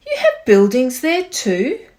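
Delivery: can't believe there are buildings; amazed question. A narrator's reading. Horton astonished at the tiny town, loud amazed question. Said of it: "Horton gasped".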